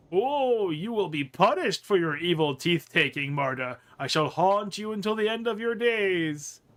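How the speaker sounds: loud, wailing ghostly threat; menacing corpse. Acted. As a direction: intone a hammed-up haunting curse. Thrown corpse voice threatens haunting.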